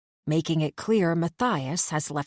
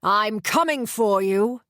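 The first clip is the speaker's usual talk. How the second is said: loud, slow, menacing slur; drunken bellow; 3 semitones higher, 4 dB louder than usual. A narrator's reading. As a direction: threaten drunkenly, dragging the words. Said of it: "he bellows".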